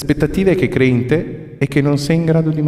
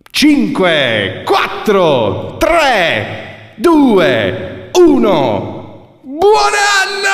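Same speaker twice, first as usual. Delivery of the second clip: excited countdown; 11 semitones higher, much slower than usual. Acted. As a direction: count down and shout happy new year. New Year countdown.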